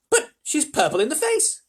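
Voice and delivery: high, loud cry of alarm; Grandpa Joe. A narrator's reading. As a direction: cry out in shocked amazement. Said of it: "cried Grandpa Joe".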